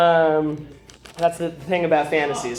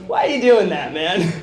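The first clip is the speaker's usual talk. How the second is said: mock exasperated question; 4 semitones higher, 6 dB louder than usual.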